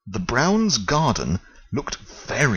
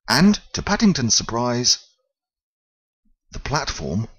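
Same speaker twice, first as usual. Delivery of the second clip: ordinary delivery, nothing marked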